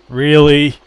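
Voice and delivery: can't believe what she's hearing; disbelieving really. Real, not acted. Reacting to odd game characters.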